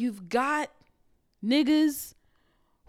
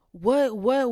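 usual speaking voice

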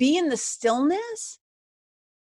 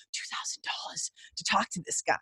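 breathless whisper of disbelief; shocked whisper; 4 semitones lower, 12 dB quieter than usual. Retold or imitated. To her listener about the coach's price, a stunned whisper. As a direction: hush it like a secret you can't believe.

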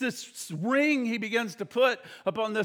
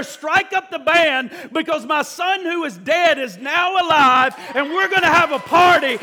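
yelling; 5 semitones higher, 6 dB louder than usual. Acted. Father yells to the band, triumphant shout. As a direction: shout it to the whole house. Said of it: "he yells in there".

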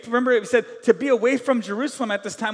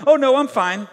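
loud, higher mock reassurance; fake fine; 5 semitones higher, 9 dB louder than usual. Churchgoer hiding trouble from others, falsely fine. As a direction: brush it off with put-on okayness. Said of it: "we don't know how to be real".

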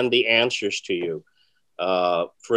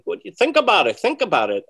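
imitating mentor; 9 semitones higher, 5 dB louder than usual. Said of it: "But he'd go".

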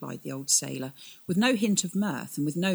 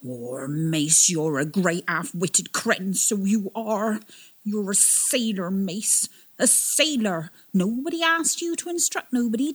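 put-on louder, higher old sailor berating himself; self-scolding; 6 semitones higher, 5 dB louder than usual. Old captain curses himself in front of the twins, loud and exasperated. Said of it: "started chastising himself".

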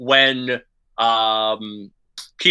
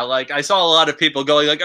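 ordinary delivery

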